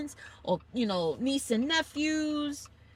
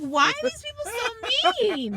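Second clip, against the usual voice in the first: much higher, slow, whiny; mock-hurt high whine at a rude cyclist; 13 semitones higher than usual. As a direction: plead like a wounded child. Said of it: "I'm like".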